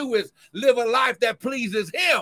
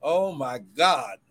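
ordinary talk, nothing marked

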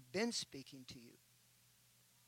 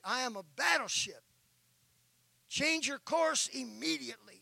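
louder, commanding battleship threat; furious captain's command; 6 semitones higher, 10 dB louder than usual. Retold or imitated. Captain signalling the lighthouse, angry and loud. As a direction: demand it like an outraged captain. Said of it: "He is furious".

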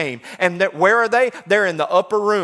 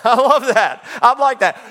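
louder, laughing through the words; laughing delight; 5 semitones higher, 5 dB louder than usual. Real, not acted. Preacher delighted by the crowd's question, laughing. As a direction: chuckle with pleasure.

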